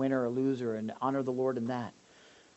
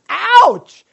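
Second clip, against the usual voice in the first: much higher and louder, a hammed-up ouch; mock wince; 20 dB louder than usual. Preacher reacting to a tough Bible verse, mock pain. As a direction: pretend the verse stings.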